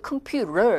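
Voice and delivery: "컴퓨터" as "kum-pyu-rer"; American flap T. Shown as a demonstration. Teacher shows American T, "컴퓨터" as kum-pyu-rer. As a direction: soften the t of "컴퓨터" to r. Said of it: "T 사운드를 R 사운드에서 가깝게".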